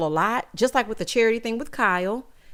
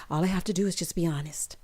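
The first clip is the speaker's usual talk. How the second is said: whispered self-rehearsal; imitated whisper; 5 semitones lower, 6 dB quieter than usual. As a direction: whisper it to yourself as if rehearsing while pacing. Rinna pacing, rehearsing to herself.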